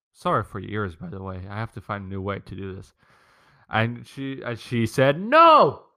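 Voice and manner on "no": much higher and louder single word; shrill refusal. Retold or imitated. His sister refusing the salad dad offers, loud and defiant. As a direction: fling out a defiant no.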